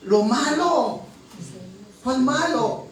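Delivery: lista de pecados lanzada alto y fuerte; predicación a gritos. Acted. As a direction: clama cada pecado a la congregación. Predicador enumera pecados gritando. (grita la lista de pecados con fuerza)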